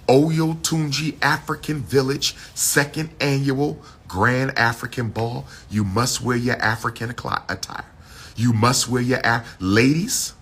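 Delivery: ordinary delivery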